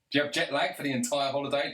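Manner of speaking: ordinary talk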